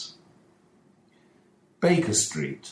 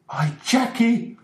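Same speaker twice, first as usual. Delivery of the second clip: much higher, slow cry of dismay; shocked shout; 4 dB louder than usual. A narrator's reading. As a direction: drag out the name in horror. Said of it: "shouted Mister Ferguson".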